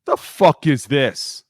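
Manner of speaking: loud, very slow, incredulous bark; mock coach scolding. O-line coach scolding a player for sliding the wrong way. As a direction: drag it out like a furious line coach.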